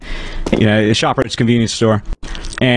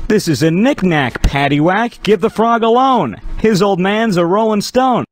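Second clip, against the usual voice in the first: excited punchline exclamation; 7 semitones higher than usual. Acted.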